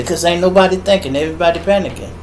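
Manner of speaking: ordinary talk, nothing marked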